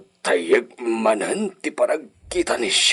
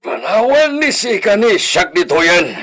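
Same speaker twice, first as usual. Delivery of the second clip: higher and louder, sharp command; insistent loud repeat; 9 semitones higher, 6 dB louder than usual. Acted. Master repeating the order to his hesitant servant, louder.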